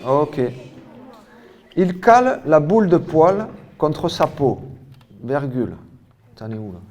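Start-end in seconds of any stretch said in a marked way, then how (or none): 1.7-5.7 high, loud classroom dictation; emphatic, carefully articulated dictation voice; dictation reading. Real, not acted. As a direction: project each word for pupils writing it down. Reads out a dictation.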